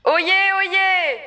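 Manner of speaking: loud, high town crier call. Acted.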